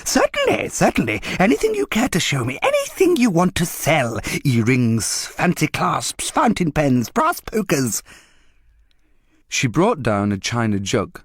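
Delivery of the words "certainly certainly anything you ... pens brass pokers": high, rising coaxing list of wares; eager dealer list. A narrator's reading. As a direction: reel them off hungrily.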